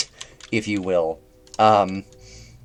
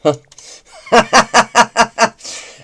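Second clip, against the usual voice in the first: high laugh; 13 semitones higher, 11 dB louder than usual. Real, not acted. Laughing at having to save again.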